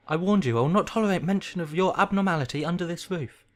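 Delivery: high, loud, indignant scolding; angry tirade. Acted. Uncle forbidding talk of abnormality, angry tirade.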